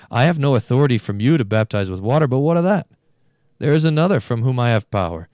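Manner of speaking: loud and rising, throwing down the challenge; bold defiance. Acted. John boldly answering the Pharisees, loud and defiant.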